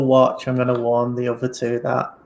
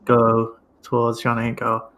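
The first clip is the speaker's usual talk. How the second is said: ordinary talk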